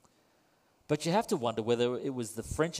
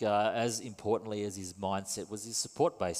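ordinary delivery

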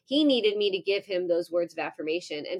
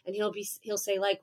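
ordinary talk

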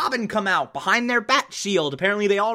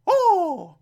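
high "oh!" sliding down; mock gasped cry; 12 semitones higher than usual. Reviewer imitating a flustered Aunt Harriet, high mock cry. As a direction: let out a squeaky, exaggerated "oh!".

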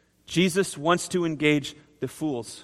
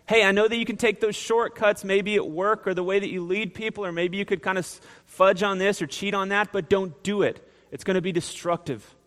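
higher, urgent pleading big brother voice; 5 semitones higher than usual. Acted. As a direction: plead like a big brother warning his siblings. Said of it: "big brother pleading with us".

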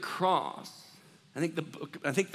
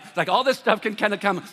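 ordinary delivery

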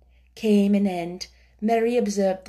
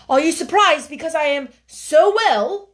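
higher, louder shy eagerness; eager boy; 7 semitones higher, 7 dB louder than usual. A narrator's reading. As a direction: tell her you longed to see her. Colin voiced.

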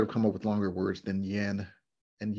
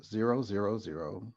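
ordinary talk, nothing marked

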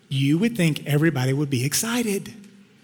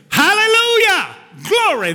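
joyful praise shout; 5 dB louder than usual. Real, not acted. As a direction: erupt in praise at full force. Preacher bursts into praise.

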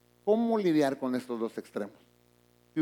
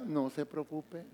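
calm, softer "No se preocupe"; soft reassurance; 9 dB quieter, slower than usual. Retold or imitated. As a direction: soothe the worried member gently. Pastor answers calmly. (pastor que tranquiliza con suavidad)

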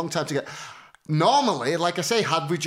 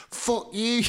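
higher, hammed-up insult; mock hater; 7 semitones higher than usual. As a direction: play the online troll. Voicing hateful inbox messages.

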